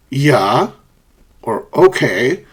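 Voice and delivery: "yeah" and "okay" drawn upward; rising emphasis on "yeah" and "okay"; emphatic rising. Shown as a demonstration. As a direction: swing "yeah" and "okay" up at the end. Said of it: "for emphasis".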